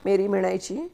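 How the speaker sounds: ordinary delivery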